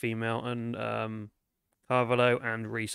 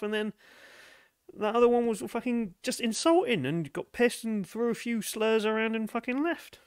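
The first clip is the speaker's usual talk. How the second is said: higher-pitched, put-out dancer; disgruntled dancer; 7 semitones higher than usual. Acted. Dancer grumbling to investigators about bad customers, disgruntled. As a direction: play an aggrieved, sweary club dancer.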